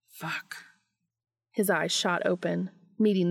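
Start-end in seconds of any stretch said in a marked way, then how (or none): 0.1-0.6 hushed curse. A narrator's reading. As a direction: let it slip out under the breath. Dean aroused, narrated thought.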